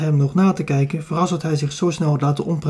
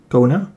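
"Kona?" with a clear rise at the end; asking for attention; 3 semitones lower than usual. A narrator's reading. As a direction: call "Kona?" as a question to get his attention. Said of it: "vragend om zijn aandacht".

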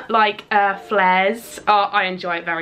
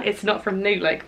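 ordinary talk, nothing marked

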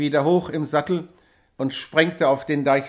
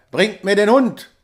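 louder, raised stern order; angry command; 4 semitones higher, 6 dB louder than usual. A narrator's reading. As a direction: demand the dog with authority. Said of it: "schrie Hauke wieder". (zorniger Befehl)

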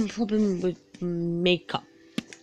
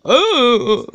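startled; 6 semitones higher, 11 dB louder than usual. Retold or imitated. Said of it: "I'm like".

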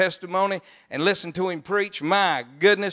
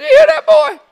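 high, loud amazed call to a friend; calling out in disbelief; 11 dB louder than usual. Retold or imitated. To his ministry partner Randy, an amazed high loud call.

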